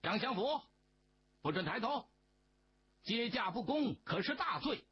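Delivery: high, barked order; stern shout. A narrator's reading. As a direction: snap the command down at him. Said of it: "师爷大声地喝道".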